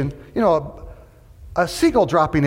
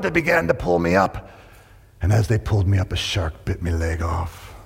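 pirate voice; 8 semitones lower than usual. Acted. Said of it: "He said".

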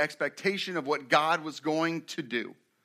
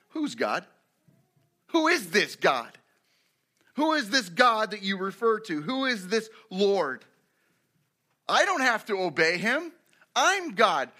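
defiant ruler, building higher and louder; Pharaoh; 4 semitones higher, 3 dB louder than usual. Acted. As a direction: challenge them slowly and emphatically, rising to a proclamation. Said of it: "Notice Pharaoh's question".

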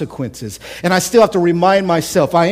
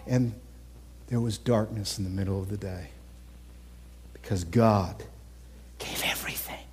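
awed hush after the crucifixion; 9 semitones lower, 16 dB quieter than usual. Acted. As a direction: drop to a soft, low near-whisper. Hushed turn in sermon.

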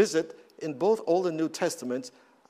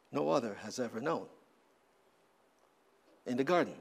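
quiet, lowered reciting of hymn lines; soft hymn recitation; 3 semitones lower, 3 dB quieter than usual. Acted. Pastor recalling the funeral hymn, soft and hushed. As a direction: murmur the hymn words tenderly.

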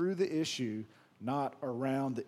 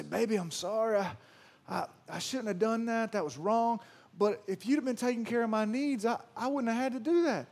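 higher mock voice of a cheating husband; mocking excuse-making husband; 6 semitones higher than usual. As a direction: ham up a husband blaming his wife. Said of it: "they'll say".

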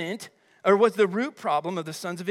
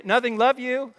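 higher, louder, sweet cover-up; feigned innocence; 5 semitones higher, 5 dB louder than usual. Acted. Kid answering a parent who heard muttering, feigned innocence. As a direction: act the kid caught grumbling, suddenly all innocence.

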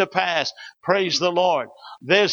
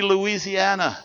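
ordinary delivery

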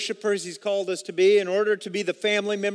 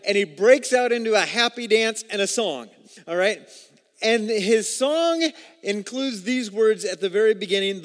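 louder, driving storytelling preach; fervent preaching; 3 semitones higher, 5 dB louder than usual. Acted. Pastor telling the Exodus story to the church, fervent and louder. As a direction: build the Red Sea story to a peak. Retelling Red Sea with fervor.

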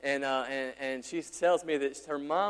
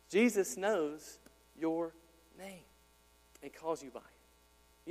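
Jesus knows your name, spaced out slowly; slow, weighted words; much slower than usual. Real, not acted. Preacher stresses to the congregation that Jesus knows their name, slow and deliberate. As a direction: lay each word down with pauses.

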